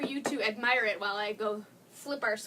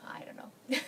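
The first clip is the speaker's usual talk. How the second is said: sheepish whisper. Real, not acted. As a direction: admit defeat under your breath. Stumped by French words.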